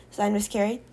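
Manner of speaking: ordinary delivery, nothing marked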